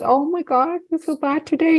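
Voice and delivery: high, soft, pitying tone; overly sympathetic coo; mock pity. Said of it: "My husband never pitied me".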